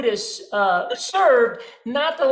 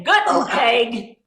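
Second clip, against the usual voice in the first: put-on slow, clumsy American-accented German; 3 dB louder, much slower than usual. American tourist greets Germans, thick American accent. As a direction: drawl out "Guten Tag" like an American. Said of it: "if an American tourist were to say".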